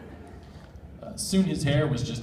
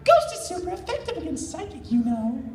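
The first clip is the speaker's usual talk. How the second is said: much higher, smug little Pokemon voice; high-voiced Mew. Mew lecturing Nate about type matchups, high and smug. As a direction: play Mew as a cheeky know-it-all.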